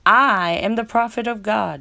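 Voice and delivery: loud, high proclamation; shouted quote. Retold or imitated. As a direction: proclaim it like a zealot. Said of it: "he shouted".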